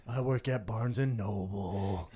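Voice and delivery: slow, low sheepish mumble; mock shy guy. Comedian imitates the embarrassed audience guy, low and slow. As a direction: say it like an awkward shy man. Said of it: "He goes".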